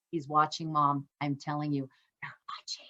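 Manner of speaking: real secretive whisper aside. Real, not acted. Aside about kids watching.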